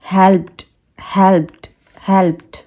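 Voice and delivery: हेल्प्ड as "helpt"; one-syllable हेल्प्ड; soft T ending. Shown as a demonstration. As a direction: blend t and d at the end of हेल्प्ड. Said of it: "का blend है".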